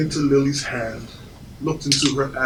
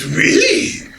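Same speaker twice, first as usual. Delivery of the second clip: high-pitched French waiter disbelief; pompous French accent; 13 semitones higher, 3 dB louder than usual. Retold or imitated. French waiter challenging a diner, pompous accent. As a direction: query it haughtily, in a French accent. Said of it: "in his pompous accent".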